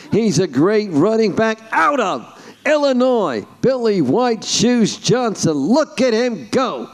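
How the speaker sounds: high, slow, stretched sportscaster cadence; Howard Cosell. Shown as a demonstration. Commentator watching a running back go, slow emphatic call. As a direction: impersonate an old-time football commentator. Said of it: "the great Howard Cosell used to do".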